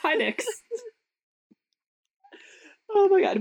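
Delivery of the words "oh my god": high loud cry of delight; delighted squeal; excited exclaim. Real, not acted. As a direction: burst out laughing exclaim. Laughing after bingo joke.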